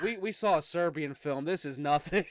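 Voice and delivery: laughing, unimpressed boast; jaded bragging. Pretend. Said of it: "We're gonna be like".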